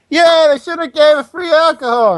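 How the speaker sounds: high, loud put-on crowd voice; planted heckler. Player runs across the mob posing as another villager, shouted. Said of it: "other side of the group and like".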